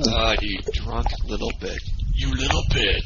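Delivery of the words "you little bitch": high, strained mocking jeer; teasing jeer. Real, not acted. Cohost jeers at him after the chug. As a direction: taunt him as he chokes.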